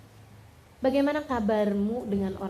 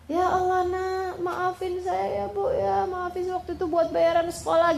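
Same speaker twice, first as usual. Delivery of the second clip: acted pleading; 7 semitones higher than usual. Child begging mother's forgiveness over school money, acted pleading. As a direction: imitate someone begging forgiveness tearfully.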